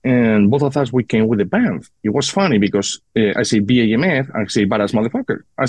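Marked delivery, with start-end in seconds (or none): none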